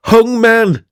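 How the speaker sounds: loud, high call across the room. Acted. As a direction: shout it to Janet down the hall. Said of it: "I called to Janet".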